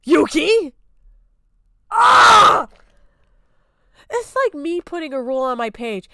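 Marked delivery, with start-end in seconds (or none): 1.9-2.7 sharp high yell of frustration; a short, loud scream of exasperation; frustrated scream. Real, not acted. Streamer reacting to the clip, screaming in frustration.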